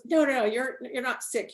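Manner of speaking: mock the healer's urgent excitement; excited insistence. Healer tells her she isn't sick, excited.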